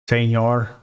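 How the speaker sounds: put-on a playful Mexican accent on Senor. Coder hails the color name Paco, playful Mexican accent. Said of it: "that's my Mexican accent".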